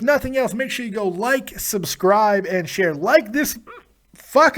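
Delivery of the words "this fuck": talking through hiccups; hiccuping mid-sentence. Real, not acted. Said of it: "I'm hiccuping".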